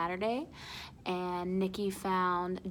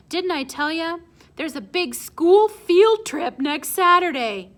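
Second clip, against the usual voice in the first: blurted fib; 8 semitones higher, 10 dB louder than usual. Acted. Nico blurting a made-up excuse to Pop, loud and high. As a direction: blurt the lie too eagerly.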